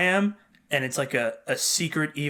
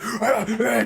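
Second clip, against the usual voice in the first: mock dog attack noise; 7 semitones higher than usual. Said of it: "he's like".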